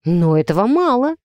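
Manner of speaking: ordinary delivery, nothing marked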